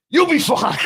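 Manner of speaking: a hammed-up, high, loud chirpy nurse; lightly voiced breezy nurse; mock nurse. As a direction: mimic the nurse brushing him off. Nurse quoted mockingly, brightly.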